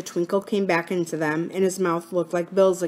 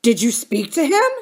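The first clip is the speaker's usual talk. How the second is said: higher, louder, astonished question; 5 semitones higher, 6 dB louder than usual. A narrator's reading. Bill asks Philip about the phone call, astonished. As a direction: ask it in open disbelief. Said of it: "his voice sounding astonished".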